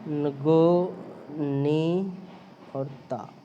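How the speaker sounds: each Chinese word set apart and stressed; teacher carefully sounding out नको, नी and ता as separate Chinese words; Chinese words spoken out. Shown as a demonstration. A Hindi teacher to students, reading Chinese words clearly. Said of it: "स्वतंत्र अर्थ इस प्रकार है".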